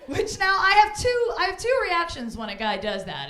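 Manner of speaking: ordinary talk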